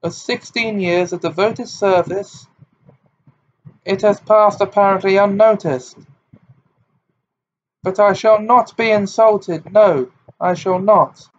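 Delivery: high and loud, tearful and proud; hurt dignity, near tears; wounded protest. A narrator's reading. Tearful teacher protests her insult.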